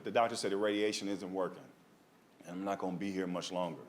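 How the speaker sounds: low, soft, subdued confession; understated imitation of a crying mother; tearful mother.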